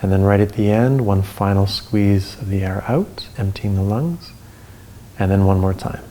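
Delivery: guided breathing. Acted.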